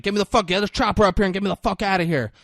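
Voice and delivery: fast, high, loud rush of orders; urgent demand. Retold or imitated. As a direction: bark rapid orders into the phone. Acted urgent phone order for escape.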